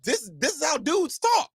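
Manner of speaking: high mock-innocent protest; his own reply voiced high. Husband replays telling his wife this is how dudes talk, high and mock-innocent. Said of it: "I'm like".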